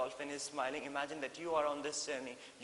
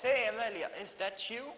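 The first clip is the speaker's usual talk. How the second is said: high, louder call of a greeting; Mary Poppins calling out to the dolphin; 6 semitones higher, 4 dB louder than usual. Retold or imitated. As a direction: call out to see who it is. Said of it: "called Mary Poppins".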